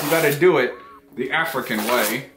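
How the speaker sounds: foil held in mouth; speaking through clenched teeth. Real, not acted. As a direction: lips parted around object.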